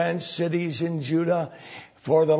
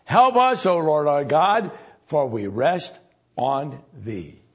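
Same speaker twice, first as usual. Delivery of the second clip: rising, loud pleading cry to God; fervent prayer; 3 semitones higher, 3 dB louder than usual. Acted. King Asa praying before the great army, fervent. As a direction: call out to the Lord for help. Reads Asa's prayer aloud.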